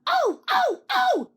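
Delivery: very high, loud squealing oh oh; screaming trucks. A narrator's reading. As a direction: squeal in alarm like silly trucks. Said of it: "they screamed".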